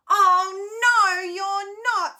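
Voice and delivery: loud, high, slow troll shout. Acted. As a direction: roar it down as the troll.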